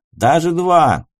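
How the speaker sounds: put-on loud drunken demand; belligerent drunk. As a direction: push it, rowdy and drunk. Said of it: "пьяно заорал он".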